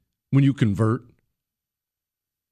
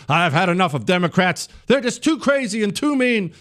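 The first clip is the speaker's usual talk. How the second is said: much higher, fast mocking impression; fake convert; 9 semitones higher than usual. Career leftist pretending to switch sides, much higher mock voice. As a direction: ham up a phony turncoat pledging loyalty. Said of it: "pretend to be on our side".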